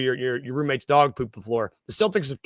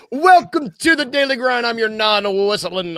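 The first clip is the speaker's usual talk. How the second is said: announcer welcome; 7 semitones higher, 6 dB louder than usual. Acted. Host opening with mock jingle energy.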